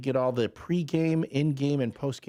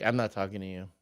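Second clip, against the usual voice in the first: sulking Harley; 6 semitones lower, 4 dB quieter, faster than usual. Acted. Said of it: "a look on her face like".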